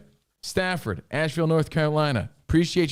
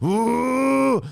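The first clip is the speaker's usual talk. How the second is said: high, loud drawn-out whoosh; mimicked martial-arts breath, pitched way up; fire-breathing noise; 11 semitones higher, 4 dB louder than usual. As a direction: imitate a fire-breather's big blowing howl. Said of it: "he does his breathing sort of".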